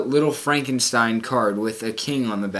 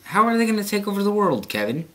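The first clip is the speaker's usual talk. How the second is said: mock viewer question; 6 semitones higher, much faster than usual. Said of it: "You're probably wondering".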